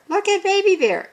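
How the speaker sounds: very high storybook character voice; Father Bear. Shown as a demonstration. Teacher showing voice change for speech marks, Father Bear's line. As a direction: switch into a high character voice for the quote. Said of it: "change your voice when you see these marks".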